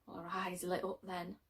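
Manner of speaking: ordinary delivery, nothing marked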